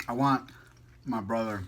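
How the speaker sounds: normal talking voice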